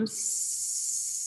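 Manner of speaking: long hissing S breath out; exhale on S. Shown as a demonstration. Voice teacher demonstrating breathing out on S. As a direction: let the air out on a steady S. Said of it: "I sound like this".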